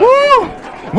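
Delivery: high, quick "woo"; excited whoop. Real, not acted. Streamer cheers in the last minute, excited whoop. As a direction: whoop for the crowd.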